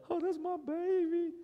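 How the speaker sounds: high, doting coo; cooing mother. Pretend. Pastor voicing mothers adoring their child, high coo. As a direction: gush over the baby like a mom.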